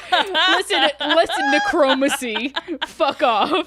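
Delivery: high, loud hammed-up rant; mock grandiose outburst. Host defends her pronunciation in a mock loud theatrical voice. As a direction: play it as a hammed-up sorcerer.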